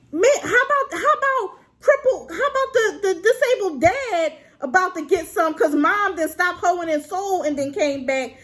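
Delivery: high-pitched incredulous ranting; exasperated, high and tumbling out; worked-up outrage. Real, not acted. Outraged rant about the dad.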